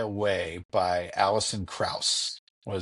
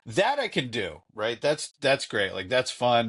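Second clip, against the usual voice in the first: usual speaking voice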